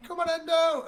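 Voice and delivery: high, loud rallying yell; encouraging shout. Acted. Bard shouting words of encouragement to Endo, encouraging shout. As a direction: shout to urge a comrade on. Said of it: "I'll just shout".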